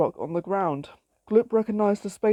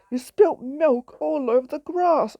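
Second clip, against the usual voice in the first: angry shout; 6 semitones higher than usual. A narrator's reading. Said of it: "shouted Dad".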